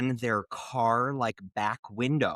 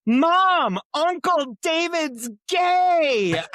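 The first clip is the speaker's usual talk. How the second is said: high, loud, slow call to mom; child announcing; 7 dB louder, much slower than usual. Retold or imitated. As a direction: call it out like a kid telling on someone. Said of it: "literally she goes".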